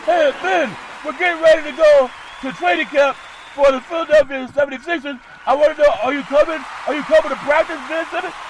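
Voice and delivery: high, loud coach calling a player; Doc Rivers. Acted. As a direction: imitate a coach hollering across the gym. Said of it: "I'm gonna try my best Doc Rivers voice".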